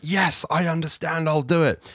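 bright eager yes, pitched high; positive. Shown as a demonstration. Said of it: "the positive way you say it".

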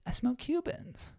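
high, hushed, slow brag; soft falsetto, drawn out and self-important; mock snob. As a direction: parody a precious cigar snob. Mocking a status-proud novice.

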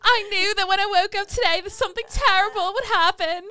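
put-on high, loud Derry accent, laughing; Northern Irish accent. Friend quoting the girl, high Northern Irish accent. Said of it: "could do some of the accents".

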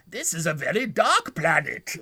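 put-on Victorian waif voice; Victorian orphan. Podcaster imitates stranded alien for co-hosts, mock Victorian orphan. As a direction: play it as a hammed-up orphan lamenting. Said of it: "says it like a Victorian orphan".

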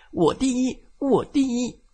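put-on high, loud childish shouting. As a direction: shout it out, me first. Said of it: "叉车大声地说". (大声喊叫)

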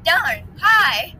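high, loud mock exasperation; rat's complaint. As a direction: whine upward as the jumping rat. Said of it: "Rat was, like, jumping up and is like".

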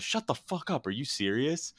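imitating high-pitched disbelief; incredulous retort. Podcaster retells Kumar reacting to his friend's story, high incredulous voice. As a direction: blurt it high, as a retold shocked reply.

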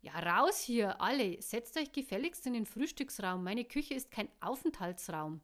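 loud, exasperated order; bossy scolding. A narrator's reading. Cook clearing everyone out of his kitchen, bossy scolding. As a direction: shoo them all out with force.

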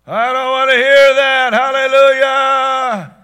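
loud, high, sustained praise shout; drowning out worship. Acted. Worshiper drowning out God's voice with praise, loud mock-worship shout. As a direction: belt it over the top of someone, drawing out Hallelujah. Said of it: "We drown him out with our worship".

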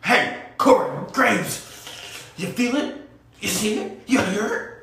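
hammed-up hype promo with a high whoop; Big E hype. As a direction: call out to the commentator like a wrestler. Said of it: "comes right back over and goes".

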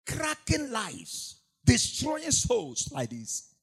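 high, slow, loud lament; preaching cry. Real, not acted. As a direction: cry out against the devil.

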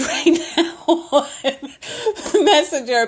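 amused, chuckling at the interruption; giggling while she complains; laughing. Real, not acted. Annoyed at someone messaging her.

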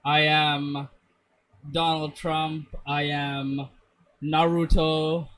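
normal talking voice

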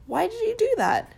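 high, loud startled question; surprised. Acted. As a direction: blurt it out in shock. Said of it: "Simba was surprised".

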